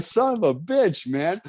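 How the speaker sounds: a high, drawn-out exasperated curse. Retold or imitated. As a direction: curse the horse after being thrown. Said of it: "I'm like".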